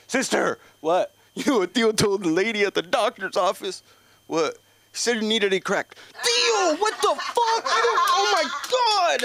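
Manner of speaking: very high, loud shriek of disbelief; shocked teens. Retold or imitated. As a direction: squeal in mock horror. Nephew and niece shocked.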